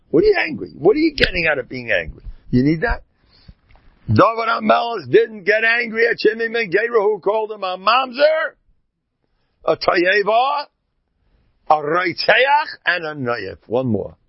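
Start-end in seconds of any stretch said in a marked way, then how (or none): 0.1-2.2 incredulous challenge. Acted. Rebuking the screaming wife. 4.1-13.6 fervent telling. Acted. Telling insults David endured.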